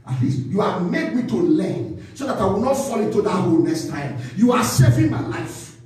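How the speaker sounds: ordinary talk, nothing marked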